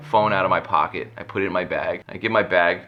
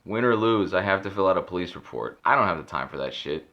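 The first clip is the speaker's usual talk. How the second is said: normal talking voice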